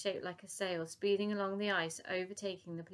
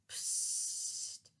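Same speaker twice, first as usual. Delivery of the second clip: whispered hiss of gas; hissing psst; 5 dB quieter than usual. A narrator's reading. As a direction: let it hiss out. Gas escaping sound.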